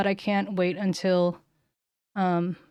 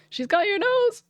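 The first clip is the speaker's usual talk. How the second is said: excited; 4 dB louder than usual. Real, not acted. Playful game moment.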